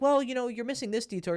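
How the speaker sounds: high, fussy mock correction; high-pitched mock "you're missing this detail"; nitpicking client. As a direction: play the know-it-all correcting his coach. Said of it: "he'll start to clarify and be like".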